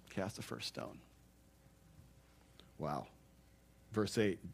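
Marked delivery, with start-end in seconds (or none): 0.1-3.1 much lower and much softer; hushed and solemn. Real, not acted. Preacher dropping to a hush on Jesus' challenge. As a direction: let the words fall to a hush. Solemn drop after quote.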